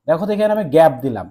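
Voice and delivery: ordinary delivery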